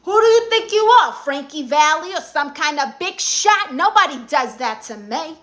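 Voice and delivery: high, loud, fast, entitled Karen rant. Acted. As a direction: go off like a furious Karen demanding a manager. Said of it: "I just did a TikTok as her".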